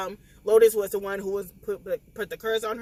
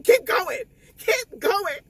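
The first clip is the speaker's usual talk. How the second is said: teary urging; 10 semitones higher, 4 dB louder, much slower than usual. Real, not acted. Urging doctors, reader crying.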